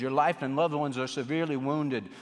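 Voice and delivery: ordinary delivery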